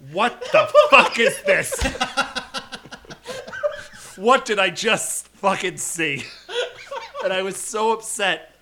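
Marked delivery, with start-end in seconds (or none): 0.1-6.2 loud, high, laughing disbelief; laughing outrage. Retold or imitated. Fan recalling the theater moment, laughing and loud. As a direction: laugh through a stunned rant. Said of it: "I remember going".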